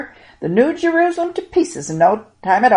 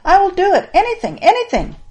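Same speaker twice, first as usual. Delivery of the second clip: higher, eager pleading; young man begging Jesus, higher and eager; 11 semitones higher, 5 dB louder than usual. Acted. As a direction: beg to know, eagerly rising. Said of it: "I can hear the young man saying".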